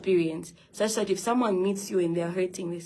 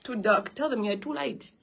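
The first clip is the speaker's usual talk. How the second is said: ordinary talk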